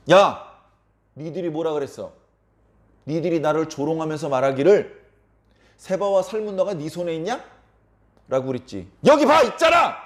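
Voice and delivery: loud, high challenge building to a shout; angry confrontation. Acted. Gideon confronts townspeople who mocked him, angry confrontation. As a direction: call them out, exploding on the last words.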